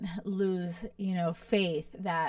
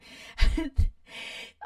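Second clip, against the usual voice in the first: a voiceless laugh, higher and louder; breathy laugh; 8 semitones higher, 5 dB louder than usual. Real, not acted. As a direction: laugh at the spoiler. Laughs after spoiler alert.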